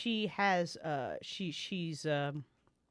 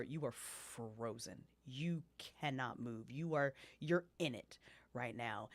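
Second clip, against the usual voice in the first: hushed narration; 4 semitones lower, 8 dB quieter than usual. A narrator's reading. GM narrates paralysis to player.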